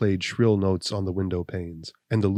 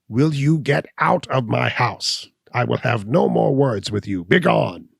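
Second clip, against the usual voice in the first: higher, louder, slow furious order; crying out; 4 semitones higher, 5 dB louder than usual. Acted. Gall ordering Abner out, louder and slower. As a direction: throw him out of the house. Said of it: "he cried".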